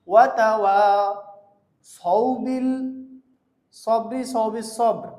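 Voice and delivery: slow, loud তাওয়াসাউ with heavy 's'; correct saad. Shown as a demonstration. Teacher showing how to read, drawn out. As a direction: make each s in সবর full and heavy. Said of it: "পড়তে হবে".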